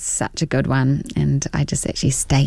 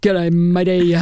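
hammed-up Kiwi twang; broad New Zealand greeting; New Zealand accent; slower than usual. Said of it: "A really strong New Zealand accent".

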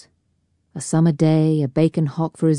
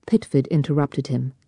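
usual speaking voice

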